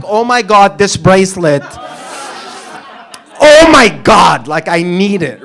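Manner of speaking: loud, high, hammed-up gushing; "oh my God" energy. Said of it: "you have to have, "Oh my God," energy".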